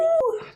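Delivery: high wolf howl. Pretend. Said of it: "That was a wolf howl".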